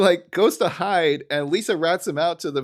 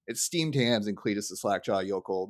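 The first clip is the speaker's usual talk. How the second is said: ordinary delivery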